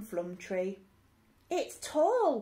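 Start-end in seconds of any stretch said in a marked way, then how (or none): 1.5-2.4 cried. Acted. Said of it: "cried Rose".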